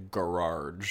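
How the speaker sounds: "garage" drawn out as guh-RAHJ; rural pronunciation. Shown as a demonstration. People from the middle of nowhere, "garage" as slow guh-RAHJ. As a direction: say "garage" slowly, broad long second syllable. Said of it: "if you're from really in the middle of nowhere".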